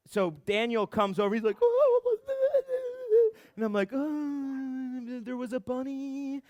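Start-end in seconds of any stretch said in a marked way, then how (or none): 1.6-3.4 very high, drawn-out wail; mock wailing friend. Friend Daniel comes over after the crash, mock wailing. 3.9-6.4 soft, high, drawn-out moan; mock pained whimper. Injured rider explaining the crash to a friend, mock whimpering.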